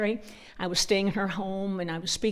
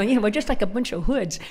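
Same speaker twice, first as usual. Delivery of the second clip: ordinary delivery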